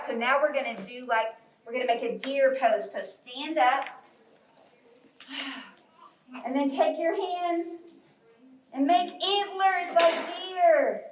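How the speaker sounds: ordinary delivery, nothing marked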